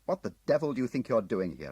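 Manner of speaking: loud furious challenge; angry shout. Acted. Tim shouting at Munslow on the hill, angry. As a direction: bark it at the intruder. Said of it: "I shouted at him".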